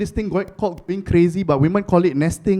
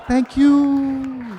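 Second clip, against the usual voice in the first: kind stranger; 5 semitones higher than usual. Retold or imitated. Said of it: "It's like".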